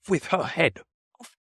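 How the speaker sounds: high, half-screamed royal command; a mild scream as the furious Queen; screamed order. A narrator's reading.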